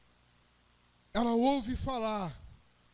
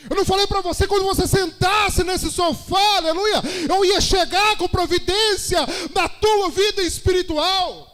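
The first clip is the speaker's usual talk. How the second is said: loud, high-pitched testimony in driving phrases; impassioned Pentecostal shout; full-force preaching; 6 semitones higher, 7 dB louder than usual. Real, not acted. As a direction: preach it at full cry, punctuated with hallelujahs.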